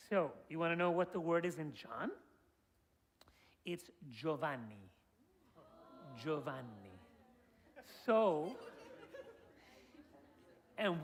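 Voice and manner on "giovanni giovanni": Giovanni said slowly the Italian way, lower; Italian pronunciation. Shown as a demonstration. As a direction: demonstrate Giovanni as jo-VAHN-nee. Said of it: "how to say John in".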